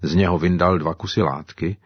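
ordinary delivery, nothing marked

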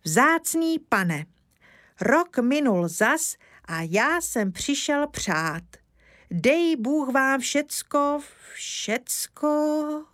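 slow, high, ceremonious verse delivery; solemn recitation. Acted. Father reciting the birthday verse, slow and solemn. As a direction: recite it haltingly like a nervous father. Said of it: "když vchází tatínek a spustí".

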